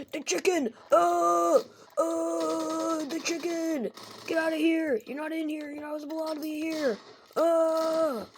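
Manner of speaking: high, loud panicked yells; panicked screaming. Acted. [name] screaming at Chica to leave his office. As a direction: scream at the chicken to get out.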